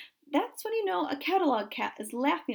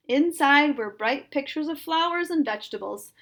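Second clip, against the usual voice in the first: ordinary delivery, nothing marked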